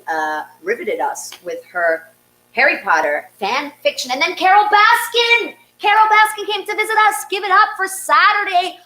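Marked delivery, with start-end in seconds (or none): 4.1-8.8 high, loud and fast hype; excited announcement. Real, not acted. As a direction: sell it as a huge surprise. Host hypes surprise guest.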